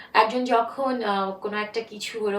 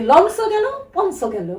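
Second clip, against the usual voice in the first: loud, slower Barisal saying; Barisal dialect; 8 semitones higher, 7 dB louder than usual. Retold or imitated. Guest repeats the funny saying after explaining it, in Barisal dialect. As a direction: punch out the imitated Barisal catchphrase. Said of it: "আর একটা শব্দ খুব ভাল লাগে".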